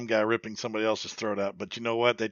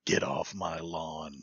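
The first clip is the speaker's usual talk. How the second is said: low, slow, soft mock threat; Clint Eastwood; 6 semitones lower, 6 dB quieter, slower than usual. Co-host spoofing the ghost's warning, low and menacing. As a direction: drop it low and draw it out like a grumpy tough guy. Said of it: "it was Clint Eastwood".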